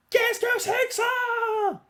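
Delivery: very high, slow, loud shocked yell; startled shout. Acted. Shocked at a shadow.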